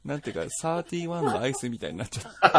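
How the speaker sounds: after laughing hard. Real, not acted. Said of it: "なんかすごい笑い転げてる".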